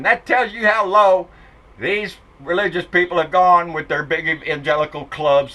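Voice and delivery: usual speaking voice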